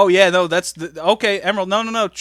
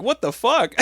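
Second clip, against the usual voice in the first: shocked; 8 semitones higher than usual. Retold or imitated. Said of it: "I'm like".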